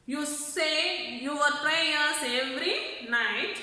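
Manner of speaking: normal talking voice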